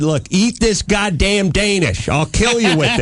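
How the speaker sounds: high-pitched mock threat; exasperated yelling. Husband threatening his wife over a pastry, comically exasperated. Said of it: "So I'm yelling at my wife".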